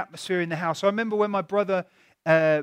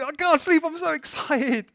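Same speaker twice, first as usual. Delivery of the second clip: higher, giddy can't-sleep voice; excited disciple; 9 semitones higher, 4 dB louder than usual. Retold or imitated. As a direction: bubble over like a kid before a trip. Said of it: "it's like".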